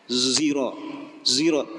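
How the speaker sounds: "zero" with a buzzing z; zero sound. Shown as a demonstration. Teacher imitating old tribes who said "zero", with a z. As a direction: say "zero", "zero" with a voiced z. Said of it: "hanya bisa mengucap".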